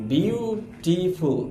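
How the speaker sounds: Beautiful in three slow beats, বিউ, টি, ফুল; split into syllables. Shown as a demonstration. Teacher presents the next word to students, beautiful syllable by syllable. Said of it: "তিনবারে উচ্চারিত হয়েছে".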